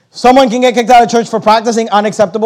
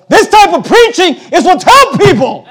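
much higher, ringing pulpit declaration; emphatic preacher's cry; 9 semitones higher, 4 dB louder than usual. Real, not acted. Preacher defending his preaching against critics, emphatic cry. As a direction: cry it out to the congregation, high-pitched.

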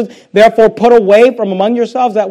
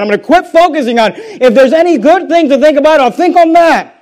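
higher, louder full-force preaching; fiery preaching; 6 semitones higher, 6 dB louder than usual. Acted. Preacher urges the church to put bitterness away, loud and high. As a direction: drive it home to the congregation.

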